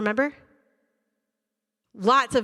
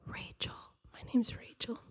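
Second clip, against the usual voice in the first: a tiny whispered self-introduction; timid whisper; 15 dB quieter than usual. Retold or imitated. Nervous student whispering her name to the caller. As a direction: whisper your name, too scared to speak. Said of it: "And they'll go".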